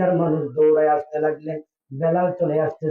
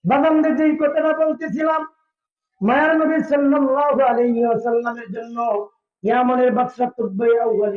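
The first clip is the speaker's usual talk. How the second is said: high, projected sermon chant; full-voiced preaching; 8 semitones higher than usual. Real, not acted. Preacher returning to his main topic, high chanted preaching. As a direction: pitch it high to address the whole crowd.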